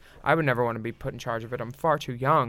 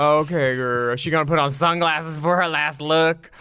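sassy judging; 2 semitones higher, 5 dB louder than usual. Retold or imitated. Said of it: "where you're like".

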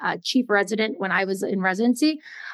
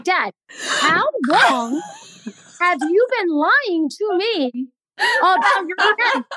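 higher, drawn-out disbelief, hammed up; mock exasperated confrontation; 7 semitones higher, 4 dB louder, much slower than usual. Daughter confronts her dad about hidden cramps, mock exasperated. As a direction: stretch the words in mock accusation of a parent.

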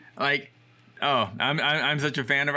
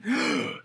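mock gasp; 4 semitones higher than usual. Old lady faints at photo.

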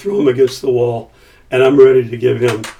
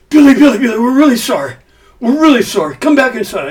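high, loud wheedling plea; pleading agent; 11 semitones higher, 5 dB louder than usual. Retold or imitated. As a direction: mock-desperate grovelling apology. Agent pleading after him.

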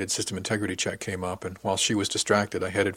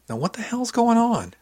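very high, loud questioning; high-pitched, insistent outburst; exasperated demand; 14 semitones higher, 5 dB louder than usual. Acted. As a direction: demand an answer, pitch shooting up. Mentor demands to know the problem.

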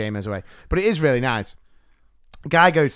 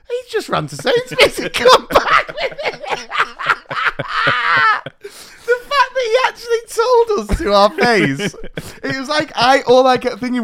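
laughing; 7 dB louder than usual. Real, not acted. Retelling absurd bar owner lie.

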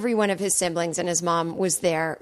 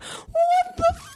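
very high, drawn-out tearful disbelief; shocked squeal; slower than usual. Retold or imitated. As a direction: squeak it slowly in horrified shock. Child's shock at crying father, retold.

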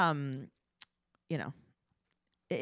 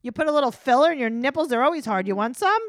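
higher, louder, fast mock husband pitch; 6 semitones higher, 3 dB louder than usual. Host mocks the cosmetic doctor husband offering his wife filler, hammed-up. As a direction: ham up a smarmy husband offering Botox. Mocking the plastic-surgeon husband.

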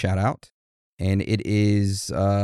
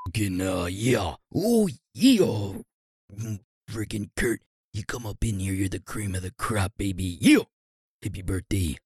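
Randy Savage; 4 dB quieter than usual. Acted. Birthday promo for Kurt, wavering wrestler impression. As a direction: growl softly, with swooping bursts on "yeah". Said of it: "Freaking Randy Savage had some personality changes".

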